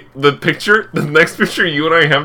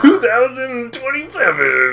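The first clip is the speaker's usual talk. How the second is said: slow, high dramatic announcer; 9 semitones higher, slower than usual. Acted. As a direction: stretch it out like a movie trailer. Drawn-out announcer year.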